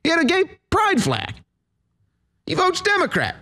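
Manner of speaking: high squeaky sarcastic voice; mocking falsetto. Host mocks the woman's view in a mocking falsetto. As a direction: squeak it out in mock cheer. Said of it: "It's like, yeah".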